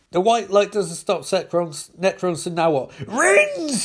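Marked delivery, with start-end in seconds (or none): 3.1-3.8 high, loud drawn-out demand; greedy shout. Acted. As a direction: yell for it imperiously. Lex Luthor demands rings.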